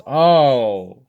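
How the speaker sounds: a cry of dismay; shocked cry. Acted. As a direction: gasp at the accident. Claws tear the prince's eyes.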